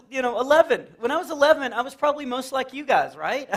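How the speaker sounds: normal talking voice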